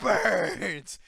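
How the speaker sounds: loud, high, drawn-out cry; mock agonized wail. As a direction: imitate her wailing in pain. Imitating the lady's burning agony.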